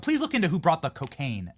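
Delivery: usual speaking voice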